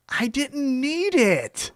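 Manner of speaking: high, drawn-out indignant retort; Kenny Omega. Acted. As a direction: stretch it out in mock affront.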